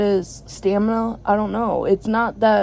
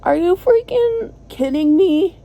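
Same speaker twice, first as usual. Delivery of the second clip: higher and louder, fed up; exasperated outburst; 9 semitones higher, 5 dB louder than usual. Real, not acted. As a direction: blurt out your frustration. Venting about bad phone signal.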